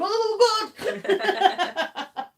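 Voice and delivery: high, loud comic yelp; mock startled cry. Host pretends dogs wake to the postman, mock startled shout. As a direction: shriek it as if jolted from sleep, playfully. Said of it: "knock at the door, and watch and go".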